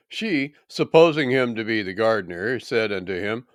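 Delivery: ordinary talk, nothing marked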